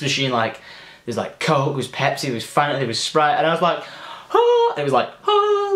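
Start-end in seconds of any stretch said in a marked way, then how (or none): 4.3-4.7 high gasp of wonder; a rapt, high intake of breath; awed gasp. Retold or imitated. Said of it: "like the Holy Grail". 5.3-5.7 high gasp of wonder; a rapt, high intake of breath; awed gasp. Retold or imitated. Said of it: "like the Holy Grail".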